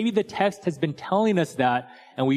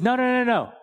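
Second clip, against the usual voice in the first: high, quick-fire string of noes; dismissive refusal; 10 semitones higher than usual. Acted. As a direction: wave the rescuers off, pitched high. Pastor refuses rescue in joke.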